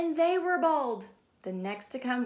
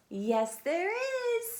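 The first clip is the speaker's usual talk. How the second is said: drawn-out insistence; 10 semitones higher, much slower than usual. Acted. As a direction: insist with glee. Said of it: "said Stephanie".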